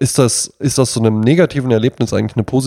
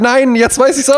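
much higher, slow, whiny mock protest; mock squeaky protest; 13 semitones higher than usual. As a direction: pipe it up like a desperate student. Mocking her protest in exam.

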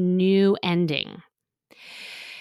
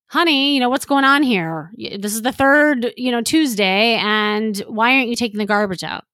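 reprimanding; 5 dB louder than usual. Shown as a demonstration. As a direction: nag him about the garbage, raised voice. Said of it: "you're just saying".

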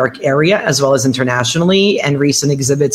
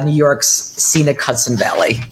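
normal talking voice

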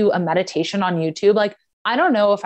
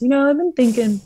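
mimicking boyfriend; 7 semitones higher than usual. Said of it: "And he goes".

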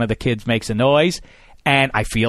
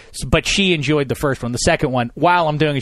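ordinary delivery

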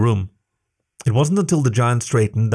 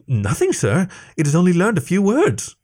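nervous stammer; 7 semitones higher than usual. Acted. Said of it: "Nikto stammered".

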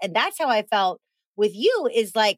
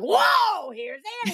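Kramer's big entrance; 10 semitones higher than usual. Acted. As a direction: burst in on a high note, comic grand entrance. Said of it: "Like Kramer coming into Jerry Seinfeld's apartment".

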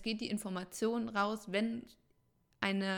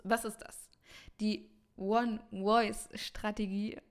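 normal talking voice

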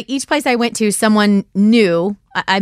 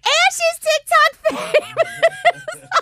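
put-on high, slow childlike boast with a laugh; kid's voice; slower than usual. A soccer kid bragging about her, high kid voice. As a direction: play a proud kid, pitched way up. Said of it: "one of the kids is like".

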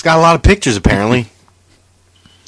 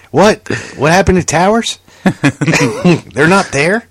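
ordinary delivery

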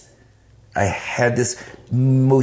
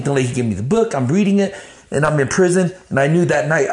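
ordinary talk, nothing marked

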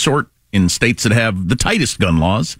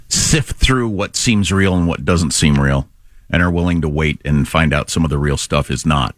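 ordinary talk

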